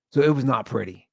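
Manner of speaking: usual speaking voice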